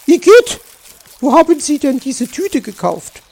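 high, loud yuck into accusing question; disgusted. Acted. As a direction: recoil from a horrid taste. Magpie disgusted by sugar egg. (angeekelt, hoch und laut)

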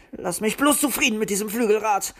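hissing. A narrator's reading. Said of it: "zischte der Kobold".

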